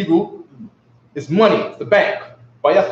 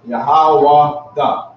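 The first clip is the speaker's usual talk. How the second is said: slow, a bit louder, syllable by syllable; "Yahweh da" stretched slowly and louder on request; slow repeat; 4 dB louder, much slower than usual. Shown as a demonstration. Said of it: "Say it again".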